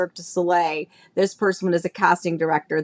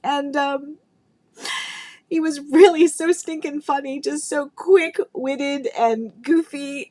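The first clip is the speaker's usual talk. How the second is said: tearful; 7 semitones higher than usual. Real, not acted. Emotional memories of late friend.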